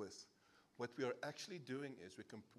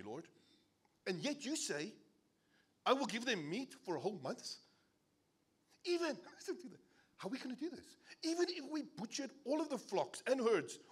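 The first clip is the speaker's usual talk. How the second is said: exasperated Moses; 7 semitones higher, 4 dB louder than usual. Retold or imitated. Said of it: "But Moses responded".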